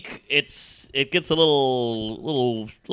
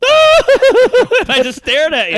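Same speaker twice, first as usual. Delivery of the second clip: much higher and louder, slow laughing line; laughing deadpan; 9 dB louder, much slower than usual. Retold or imitated. Guy staring after farting, loud slow laughing delivery. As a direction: laugh through it slowly and loud. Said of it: "But if I just went".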